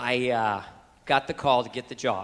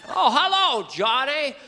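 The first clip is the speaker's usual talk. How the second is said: high, loud hello in character; surprised greeting; 11 semitones higher, 5 dB louder, slower than usual. Acted. Mister Stewart greets Johnny, high and loud. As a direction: hail an acquaintance in a put-on high voice.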